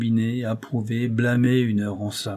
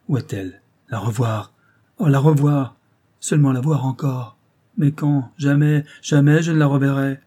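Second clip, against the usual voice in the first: higher, frantic lament swinging into cries; anguished young lover; 4 semitones higher than usual. Acted. Mohsen alone in the street, despairing over Jemileh, frantic. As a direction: torment yourself aloud over the woman you lost.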